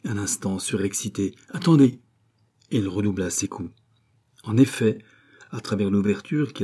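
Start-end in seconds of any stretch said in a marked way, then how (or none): 1.5-2.0 loud, raised-pitch command; pressing cry; urgent calling out. A narrator's reading. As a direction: call out to hold on. Rescuer urgently calls to wait.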